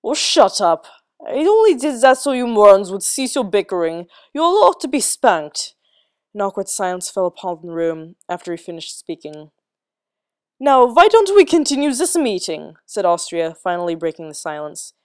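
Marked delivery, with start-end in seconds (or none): 0.0-5.8 louder, higher irritated snap; scowling retort. A narrator's reading. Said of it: "Austria scowled". 10.6-12.8 brisk. A narrator's reading. Austria breaking the silence.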